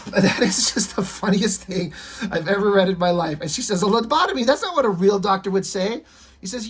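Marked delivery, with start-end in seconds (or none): 3.8-6.0 indignant Susie. Acted. Susie objecting to Calvin.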